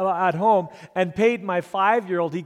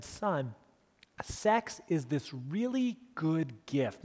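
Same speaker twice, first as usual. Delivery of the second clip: put-on slow and softer, tender to a five-year-old; gentle fatherly; 2 semitones lower, 9 dB quieter, much slower than usual. A father tells his young son, gently and slowly. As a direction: ease the truth in gently.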